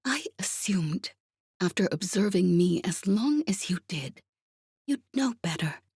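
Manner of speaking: assured near-whisper; calm, measured confidence; calm assurance. A narrator's reading. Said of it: "all the calm assurance she could muster".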